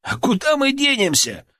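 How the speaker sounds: wry retort. A narrator's reading.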